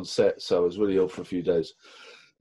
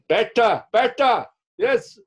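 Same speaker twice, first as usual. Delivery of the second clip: high, loud cheering on of the take; a director egging him on, pitched high and loud; enthusiastic director; 13 semitones higher, 6 dB louder, slower than usual. Retold or imitated. Director praising his wriggling on set, high and loud.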